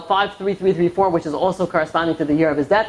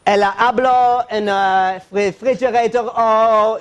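slow mock-Spanish with added O endings; bad Spanish accent; 3 semitones higher, slower than usual. Employer speaking to a maid, bad Spanish accent. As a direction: drag out fake Spanish for the maid. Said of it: "Speaking to a maid".

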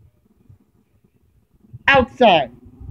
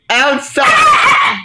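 shouted command; 7 semitones higher than usual. Real, not acted. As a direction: bark the command at the dog. Calling dog firmly.

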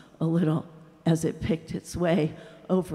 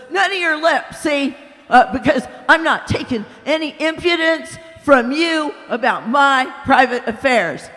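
loud, higher indignant tirade; angry ghost; 5 semitones higher, 5 dB louder than usual. A narrator's reading. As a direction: refuse him with contempt. Ghost rebuffs the other.

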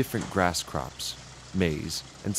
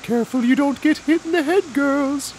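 very high, fast, louder cartoon cow; a squeaky cow warning her friends; 6 dB louder than usual. Cow warning the other girls, very high and fast. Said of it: "says another cow".

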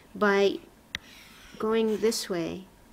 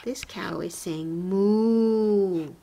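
slow, drawn-out "moo" at the end, a little louder; slow storytelling; 4 dB louder, much slower than usual. Shown as a demonstration. Teacher giving class a cow memory hint, slow and drawn-out. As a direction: stretch it out like a picture-book story. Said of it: "bubbles next to somebody's mouth".

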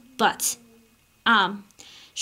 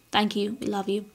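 ordinary talk

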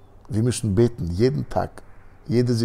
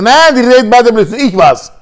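much higher and louder, insisting; defiant cry; 13 semitones higher, 12 dB louder than usual. Acted. Third girl to the guard, defiant loud claim. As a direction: shout over the others to take the blame.

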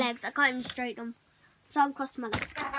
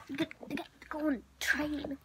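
whisper; 9 dB quieter, faster than usual. Real, not acted. Whispered aside.

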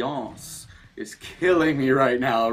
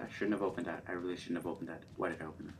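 quiet regret; 8 semitones lower, 14 dB quieter, much faster than usual. Real, not acted. Regret while hiding.

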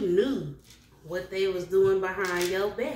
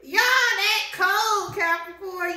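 amused awe; 9 semitones higher, 4 dB louder, slower than usual. Real, not acted. Laughing admiration, drawn out.

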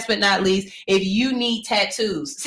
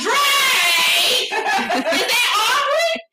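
starstruck shout; 13 semitones higher, much slower than usual. Retold or imitated. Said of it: "I be like".

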